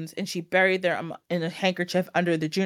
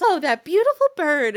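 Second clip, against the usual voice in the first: high, loud gushing; hammed-up admirers; mock swooning crowd; 7 semitones higher, 6 dB louder than usual. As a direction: gush over the bird in a high voice. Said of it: "everyone's just".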